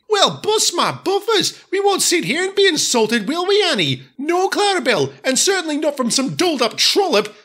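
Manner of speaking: put-on high, huffy old ladies; indignant old coaches. Annie and Clarabel protest Daisy's insult, high and huffy. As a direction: bristle like offended aunties.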